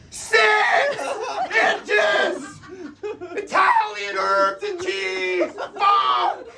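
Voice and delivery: losing it at the counter, yelling each word; exasperated shouted order; frantic shouting. Retold or imitated. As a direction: shout the order, high and strained, laughing through it. Reenacted meltdown at Subway counter.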